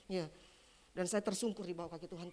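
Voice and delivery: ordinary delivery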